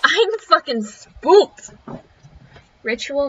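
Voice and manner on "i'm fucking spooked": spooked. Real, not acted. Player spooked by horror game.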